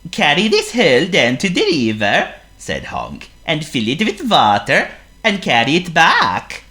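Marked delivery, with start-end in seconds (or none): 0.1-2.3 Honk the seal. Acted. Honk's voice. 3.5-6.6 Honk the seal. Acted. Honk's voice.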